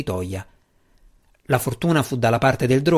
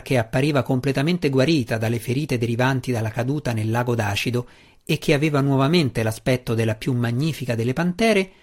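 usual speaking voice

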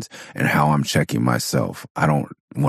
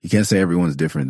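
usual speaking voice

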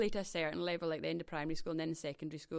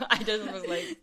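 laughing; 4 semitones higher, 11 dB louder than usual. Real, not acted. Laughing at her own doll.

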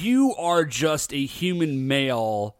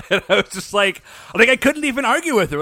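laughing through the words, higher and louder; can't stop laughing while telling it; 3 semitones higher, 4 dB louder, faster than usual. Real, not acted.

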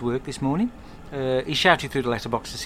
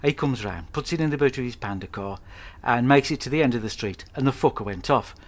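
ordinary talk

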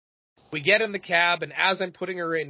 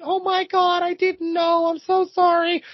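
much higher, sweet gushing apology; sweet apologetic server; 14 semitones higher, 5 dB louder than usual. Retold or imitated. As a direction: squeal sorry like a flustered waitress. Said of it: "This server was so sweet".

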